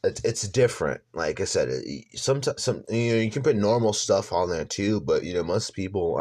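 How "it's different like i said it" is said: ordinary delivery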